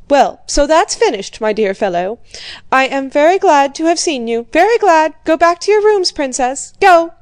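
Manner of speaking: loud, high, dismissive commands; an old prince dismissing his daughter and guest; brusque dismissal. A narrator's reading. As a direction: send them all off with forced cheer. Old prince dismisses everyone forcefully.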